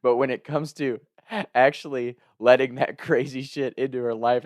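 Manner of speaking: normal talking voice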